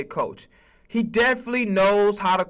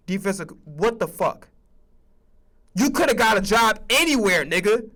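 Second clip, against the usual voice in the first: usual speaking voice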